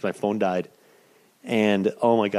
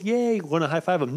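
high-pitched mock kid cheer; excited little kid; 7 semitones higher than usual. Little kids wanting to high five the wrestler, mock excited. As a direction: imitate an eager small child. Voices eager four-year-old fans.

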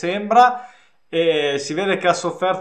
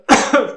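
sudden cough as a drink goes down wrong; a sharp cough, something went down the wrong pipe; choking cough; 6 semitones higher, 5 dB louder than usual. Real, not acted. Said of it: "è andato di traverso". (colpo di tosse improvviso)

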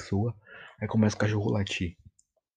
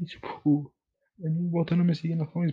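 frustrated rant; 6 semitones higher than usual. Real, not acted. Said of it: "Eu tô super puto".

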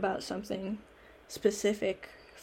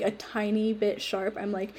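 ordinary talk

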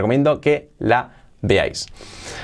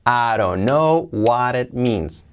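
slow linked model; much slower than usual. Shown as a demonstration. Teacher before adding intonation, slow even model. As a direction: draw out each linked chunk evenly. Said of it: "la hacemos suavecita".

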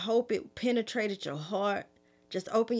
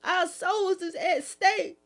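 high, impassioned, urgent preaching, voice raised; emotional preaching; 8 semitones higher, 3 dB louder than usual. Real, not acted. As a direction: plead with the people to wake up, full of emotion. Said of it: "I got a little bit emotional".

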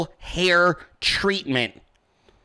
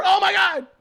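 high, held cry of alarm; mock horror; 14 semitones higher than usual. Reacting to crocs dragging a man under, mock horrified cry. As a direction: cry out in hammed-up panic at a sudden attack. Acts out horror at crocodile attack.

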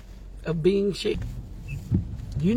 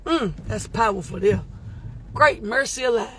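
normal talking voice

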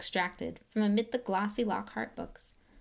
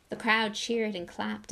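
usual speaking voice

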